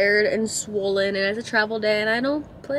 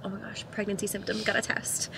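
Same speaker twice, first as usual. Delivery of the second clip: hushed quote; 3 semitones lower, 12 dB quieter than usual. Retold or imitated. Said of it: "I was like".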